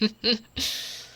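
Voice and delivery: short, light laugh; small chuckle. Acted. Orc woman telling of her father's approval, small chuckle. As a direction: chuckle briefly to yourself.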